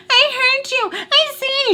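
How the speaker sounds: high, whiny mimicry of the boyfriend; mocking voice. Mimicking the boyfriend's accusation in a high mocking voice. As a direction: parrot his accusation in a silly high voice.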